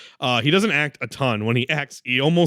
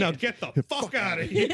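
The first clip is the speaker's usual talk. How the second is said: laughing; 10 semitones higher, 4 dB quieter than usual. Retold or imitated. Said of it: "quoting Ford".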